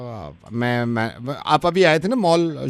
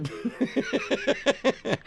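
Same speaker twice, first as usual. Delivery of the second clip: soft laugh; 11 semitones higher, 10 dB quieter than usual. Real, not acted. Host laughs at callee.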